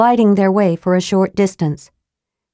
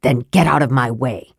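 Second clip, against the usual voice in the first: gruff dwarf; 6 semitones lower than usual. A narrator's reading. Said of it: "he bellowed".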